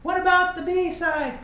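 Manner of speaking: high, loud mock shout at the screen; mock yell. Viewer yelling at the video about the B-side, mock outraged shout.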